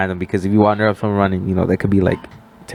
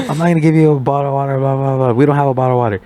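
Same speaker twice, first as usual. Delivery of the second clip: fast, higher mock yell of grandma; retold yelling; 5 semitones higher, faster than usual. Grandma refusing the driver water, fast, raised mock yell. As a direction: rattle off the refusal, pitched up. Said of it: "She's literally yelling in Spanish".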